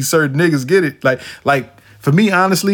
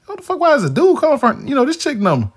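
shocked; 12 semitones higher than usual. Retold or imitated. Shocked inner reaction to call.

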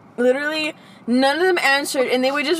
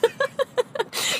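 giggle; 13 semitones higher, 5 dB quieter than usual. Real, not acted. Laughing again.

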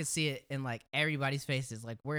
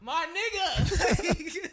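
very high, very slow, drawn out through laughter; laughing disbelief; 12 semitones higher, 5 dB louder, much slower than usual. Retold or imitated. Answering the guy who asked if they'd take his money, laughing disbelief. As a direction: stretch it out, cracking up.

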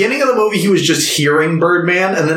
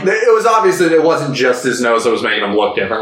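ordinary talk

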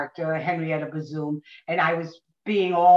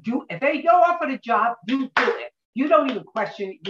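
Dodie's advice; 7 semitones higher, 3 dB louder, faster than usual. Retold or imitated. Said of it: "she was like".

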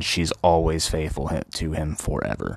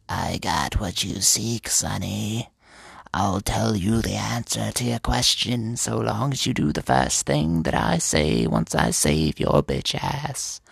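put-on old crone drawing out her words; slow, put-on hag voice offering a bargain; 3 semitones higher, slower than usual.

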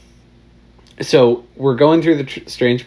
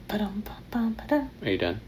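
hushed whisper; 11 semitones higher, 11 dB quieter, much slower than usual. Real, not acted. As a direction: whisper it off-mic. Whispered aside during recording.

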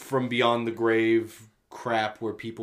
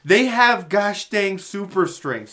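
worked-up rant; 5 semitones higher, 5 dB louder, slower than usual. Real, not acted. Ranting about scary troll dolls.

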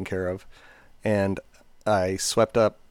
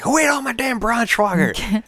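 high, loud, slow mock grumble; angry dad; 14 semitones higher, 5 dB louder, slower than usual. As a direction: scold the kids like a cranky father. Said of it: "And he was like".

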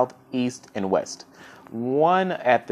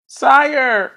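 much higher, loud desperate cry of "Sire"; a cornered courtier begging for his life, pitched way up; panicked pleading minister; 8 dB louder than usual. Acted. Minister begs the angry king.

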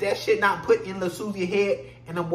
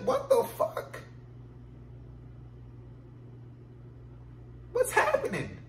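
high exasperation; 6 dB quieter than usual. Real, not acted. Exasperated high mock outburst.

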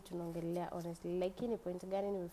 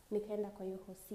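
normal talking voice

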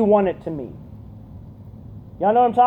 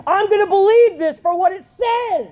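preaching shout, pitched up high; near yell; 9 semitones higher than usual. Real, not acted. Preacher driving the point to the youth group, near shouting. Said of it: "trying not to yell".

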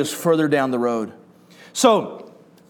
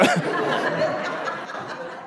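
airy laugh, higher, softer; breathy high laugh; 13 semitones higher, 7 dB quieter than usual. Real, not acted. Laughing at his story.

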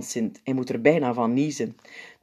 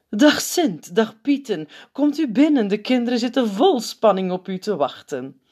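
high, louder sister's voice; Zuster Grootneus welcome; 7 semitones higher, 4 dB louder than usual. A narrator's reading. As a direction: welcome them brightly.

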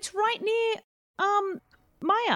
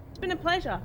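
ordinary delivery

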